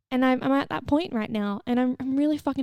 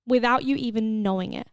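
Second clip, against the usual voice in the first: ordinary delivery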